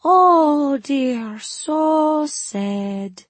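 high, loud fake Suli lament; overdone fortune-teller pity; mock sorrow. Feigned dismay in fake accent.

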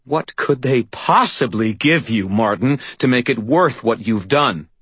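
high-pitched scornful demand; bitter confrontation. A narrator's reading. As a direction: challenge the traitor with pained disbelief. Susan confronts the traitor.

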